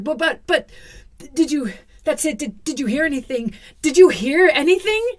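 high, panicked sputtering; stammering in fear. Acted. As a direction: stammer it out terrified. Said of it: "sputtered and stammered".

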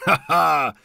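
watchmaker's loud laugh. A narrator's reading. Watchmaker laughs at Mendel's retort, laughing. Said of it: "roześmiał się zegarmistrz".

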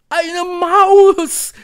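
very high, loud shriek; piercing witchy squeal; screeching witches. A narrator's reading. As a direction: screech it like a delighted coven. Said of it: "kreischten die Hexen".

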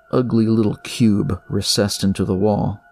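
ordinary delivery, nothing marked

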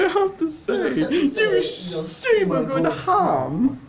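put-on high, loud, drawn-out British accent; exaggerated British accent. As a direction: ham up the British, slow and loud. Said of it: "Every time he goes into that British accent".

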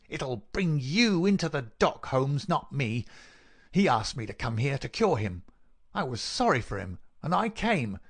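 indignant villain, pitch leaping high; high snarling voice. A narrator's reading. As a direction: snarl your defiance at the man who trapped you. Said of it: "cried the high, snarling voice".

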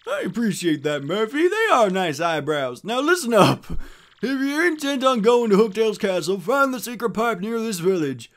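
high, loud, animated mayor; bossy village mayor. Acted. Mayor sending Murphy after Hooktail, animated and insistent. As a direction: send the hero off with instructions.

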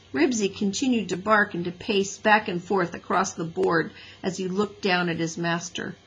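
ordinary delivery

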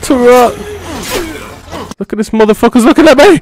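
panicked high shouting; breathless fright. Real, not acted. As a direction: yell in sudden fright. Ambushed in game, panicking.

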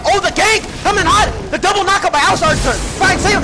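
high, loud, rushed shoutcasting; frantic play-by-play. Real, not acted. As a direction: shout the ambush as it lands. Gank erupts in match.

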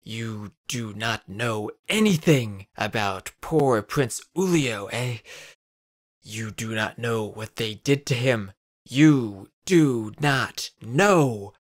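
slow, building from loud to very loud. A narrator's reading. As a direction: swell with every word into a slow fierce cry. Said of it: "his voice grew louder with every word".

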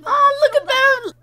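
very high, loud squeal; gushing squeal. Real, not acted. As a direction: squeal with delight at a cute sight. Gushing over the characters.

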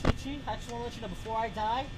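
very low, hushed voice; mock-solemn, subdued mumble; deathbed confession. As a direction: confess quietly with your last words. Mock last confession before dying.

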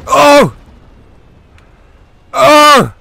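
huge shocked yell; screaming. Real, not acted. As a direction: scream at the screen. Tense game moment, screaming.

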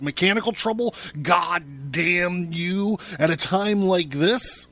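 high, loud outburst that slows on the curse; furious yell. A narrator's reading. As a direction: rage at a subordinate, dragging out the curse. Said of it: "yelled Mister Thompson, leaping to his feet".

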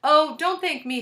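high, loud schoolgirl voice; Allison. A narrator's reading. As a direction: pipe up modestly like a pupil. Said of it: "said Allison's voice".

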